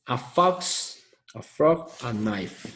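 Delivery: teacher modelling English words; English model words. Shown as a demonstration. Teacher reading the examples aloud to students, clear English. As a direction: say "A fox, a frog, a knife" clearly for the class. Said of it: "Ahí tenemos, miren".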